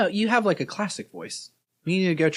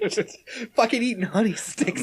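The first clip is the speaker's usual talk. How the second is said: laughing; 5 semitones higher than usual. Real, not acted. Laughing about eating honey sticks.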